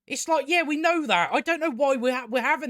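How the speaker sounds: high, loud exasperated outburst. Real, not acted. Ranting about a repetitive show, high exasperated yell. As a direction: throw it up high in disbelief.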